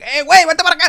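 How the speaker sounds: high, loud call-out; acted shouting across a kitchen; imitated kitchen guy yelling. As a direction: holler to a coworker in alarm. Kitchen guy calls out in quarrel.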